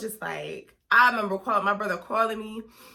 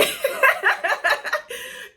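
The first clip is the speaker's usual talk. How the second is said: bursts into a high, breathy laugh; 4 dB louder than usual. Real, not acted. Laughing at how dramatic she was, high laugh. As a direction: cracks up at herself.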